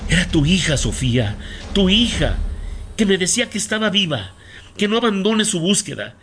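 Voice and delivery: high, intense and insistent; urgent revelation. Acted. Medium telling a mother of her daughter's plea, urgent.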